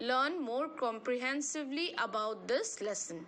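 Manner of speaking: slow, deliberate reading of the rule; measured classroom read-aloud; textbook reading. Acted. Reads exercise instructions aloud.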